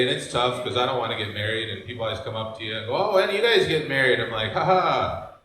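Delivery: ordinary talk, nothing marked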